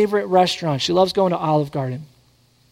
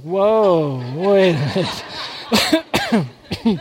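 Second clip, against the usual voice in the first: laughing mock surprise; slower than usual. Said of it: "I was like".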